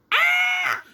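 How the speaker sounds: sudden high panicked yell; short shrieking ah of nerves; panicked shout. Real, not acted. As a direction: blurt out a burst of panic. Panicked yell about presentation.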